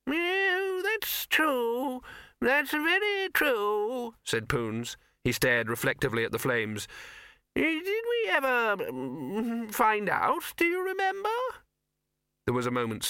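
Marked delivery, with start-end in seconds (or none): 0.1-4.1 put-on high, quavering old wizard; creaky doddering old man, high pitched. 7.5-11.4 put-on high, quavering old wizard; creaky doddering old man, high pitched. Poons voice again.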